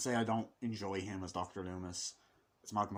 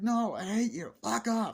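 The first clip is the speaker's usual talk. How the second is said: put-on high, loud childish refusal; mock bratty anger; 11 semitones higher, 5 dB louder than usual. Boy rejecting his mother, mock angry outburst. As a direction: imitate a kid lashing out.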